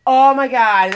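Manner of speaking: loud burst of acted excitement; acted elated outburst; excited exclamation. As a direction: blurt it out loud, thrilled. Acts out reaction to Flynn news.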